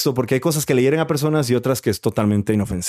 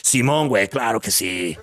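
low, mock Mexican; Mexican slang; 3 semitones lower, slower than usual. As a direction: shrug it off like a local. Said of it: "y no sé si". (acento chilango burlón)

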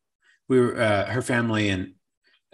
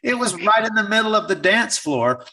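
high-pitched, emphatic storyteller; Lee Dog; 9 semitones higher, 4 dB louder than usual. Retold or imitated. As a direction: confirm it emphatically in a much higher voice. Acts out Lee Dog's animated reply.

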